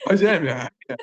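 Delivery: high imitated drunk babble, laughing; agreeing drunk guy. Drunk guy babbling back to the missionary, giggly imitation. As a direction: mimic an eager drunk, pitch up. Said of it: "then the drunk guy was like".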